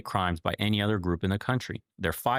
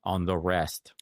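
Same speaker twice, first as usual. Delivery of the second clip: usual speaking voice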